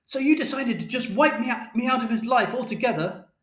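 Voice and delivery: high, accusing outburst; angry mother shouting. A narrator's reading. Mother confronting Father over their son, high-pitched shout. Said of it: "And Mother shouted".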